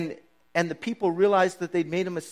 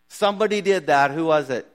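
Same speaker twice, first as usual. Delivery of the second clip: louder, higher parent demanding answers; exasperated parent; 3 semitones higher, 8 dB louder than usual. Pretend. Parent grilling the kids over a broken thing, loud and demanding. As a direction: push hard for a confession.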